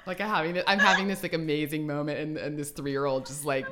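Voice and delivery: loud, still laughing while telling; real laughter in the voice; laughing through words. Real, not acted. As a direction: talk through the giggles.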